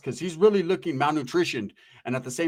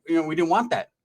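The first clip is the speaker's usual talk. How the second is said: usual speaking voice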